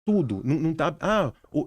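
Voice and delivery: normal talking voice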